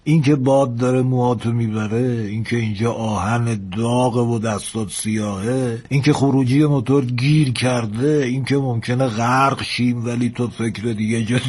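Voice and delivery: put-on slow, rhythmic listing in an old sailor's voice; animated old engineer dictating a love letter; old engineer, coaxing. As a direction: roll out example after example for the lad.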